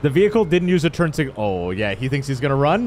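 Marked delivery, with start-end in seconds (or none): none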